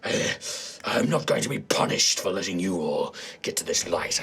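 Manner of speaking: low, groaning villain voice; wounded demonic commander. Acted. Demonic commander refusing to be punished after a crossbow hit, low menacing growl. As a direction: growl it through the pain, pitched well down. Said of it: "the commander is like".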